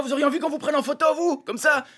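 high-pitched indignant challenge; mock outraged questioning; imitated angry man. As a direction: confront someone as the furious passenger. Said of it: "le mec vénère".

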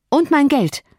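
high-pitched, indignant interjection; defiant retort. Acted. Cathy keeps on at her father-in-law, defiant.

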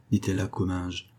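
ordinary delivery, nothing marked

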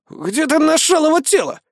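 indignant braggart. A narrator's reading. Iggy denies bragging to the crowd, high insistent voice. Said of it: "спросил Игги".

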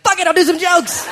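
loud, high, laughing yes; tipsy comedian caving in, loud and laughing; excited giving in. Retold or imitated. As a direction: throw caution away with a laugh. Drunk decision.

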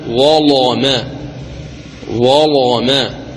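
slow recited ظلما with a thick, velarized L; careful tajweed demo, heavy lam in ظلما. Shown as a demonstration. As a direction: stretch ظلما, darkening the "la" after ظ. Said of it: "مثال الظاء قوله تعالى".

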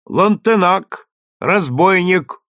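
booming crier. A narrator's reading. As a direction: boom each word out like a trumpet. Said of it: "Голос глашатая гремел теперь как труба".

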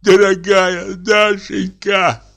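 poisoned man tearfully calling Dashenka; tearful plea. A narrator's reading. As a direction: drag out each word like a sob. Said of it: "сказал он плачущим голосом".

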